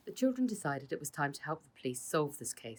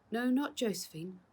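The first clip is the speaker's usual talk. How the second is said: urgent warning, higher; 7 semitones higher than usual. A narrator's reading. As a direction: stop him, firmly. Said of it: "warned Father".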